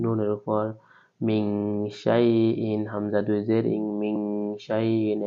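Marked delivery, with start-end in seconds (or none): 1.2-2.9 মিং শাইইন with a long nasal hum before শাইইন; nasal hiding. Shown as a demonstration. Teacher demonstrating ikhfa nasalisation to students. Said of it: "এখানে ইকফারা ক সিন রয়েছে". 4.0-5.3 মিং শাইইন with a long nasal hum before শাইইন; nasal hiding. Shown as a demonstration. Teacher demonstrating ikhfa nasalisation to students. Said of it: "এখানে ইকফারা ক সিন রয়েছে".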